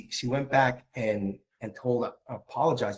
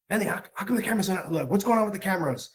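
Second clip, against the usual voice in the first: higher, quicker quoted wife; retold query, pitched up and rapid; wife's question; 7 semitones higher, faster than usual. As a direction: imitate a wife asking in a hurry. Retells wife's question at the door.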